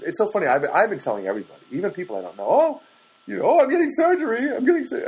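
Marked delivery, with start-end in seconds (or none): 2.5-5.0 high, loud gushing; hammed-up excitement; mock excited announcing. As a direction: announce it to strangers in a high, eager voice. Acts out telling everybody the news.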